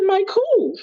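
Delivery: high, startled mock disbelief; retelling being told to mic up, high startled query; startled question. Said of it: "I said".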